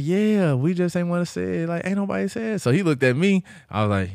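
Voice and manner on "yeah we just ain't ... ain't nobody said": high-pitched voice for the caterers; higher, put-on reply; imitated caterers. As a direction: retell their admission in a raised pitch. Caterers' sheepish admission, retold.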